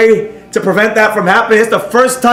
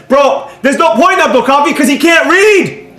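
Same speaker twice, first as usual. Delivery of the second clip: raised, heated ranting at chat; angry rant; 7 semitones higher than usual. Real, not acted. Streamer ranting at a chat viewer who can't read, angry. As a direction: vent hard, voice climbing high.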